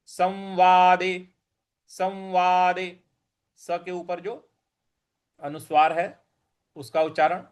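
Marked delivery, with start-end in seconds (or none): 0.1-3.0 संवादे said slowly as सम्वादे; slow, anusvara sounded as म in संवादे. Shown as a demonstration. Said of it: "हमें म के हिसाब से ले आना है". (संवादे में अनुस्वार म जैसा)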